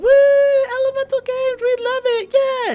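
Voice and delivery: very high, loud fan squeal; screaming crowd. Acted. Crowd reacting to the games announcement, high screaming cheer. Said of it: "Everyone starts screaming".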